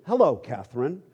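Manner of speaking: ordinary delivery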